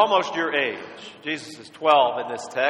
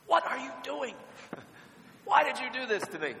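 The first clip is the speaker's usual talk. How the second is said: put-on high, exasperated mom scolding her lost kid; mock-frantic parent voice; 10 semitones higher, 5 dB quieter than usual. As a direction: play the frantic mom finding her child.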